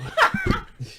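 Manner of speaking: a sudden high burst of laughter; high giggle. Real, not acted. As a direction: break up giggling. Breaks up laughing.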